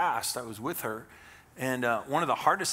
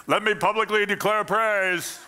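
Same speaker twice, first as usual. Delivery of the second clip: loud, pompous proclamation in a restaurant; hammed-up announcement to the room; mock public declaration; 8 semitones higher, 7 dB louder than usual. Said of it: "if you stand up at the restaurant".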